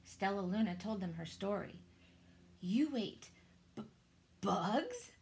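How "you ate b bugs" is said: stuttering. A narrator's reading. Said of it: "stuttered one".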